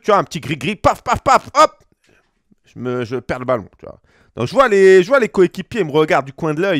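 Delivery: normal talking voice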